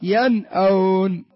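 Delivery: slow "ينأون" with a clear ن before the hamza; إظهار النون. Shown as a demonstration. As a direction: split "ينأون" after a fully sounded ن, drawn out. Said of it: "ظهرت النون ولا ما ظهرت؟". (نطق "ينأون" ببطء مع إظهار النون قبل الهمزة)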